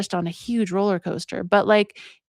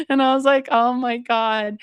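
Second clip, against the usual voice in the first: laughing through the words, pitched up; 6 semitones higher than usual. Real, not acted. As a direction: let real laughter carry the line. Laughing over the young boyfriend.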